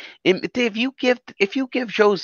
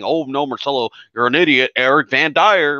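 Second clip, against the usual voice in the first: mocking the critics; 3 semitones lower than usual. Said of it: "Everybody was saying".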